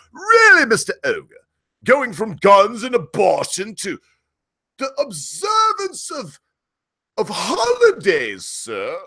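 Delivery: high, slow, hammed-up posh British voice; British accent. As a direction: play a prim British critic, very high and drawn out. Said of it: "I can hear the British right now".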